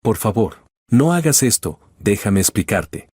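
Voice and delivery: usual speaking voice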